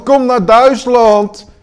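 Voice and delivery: high, slow, booming lure; hammed-up shouting invitation. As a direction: call out loudly like a party tempter. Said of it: "staat er een te schreeuwen".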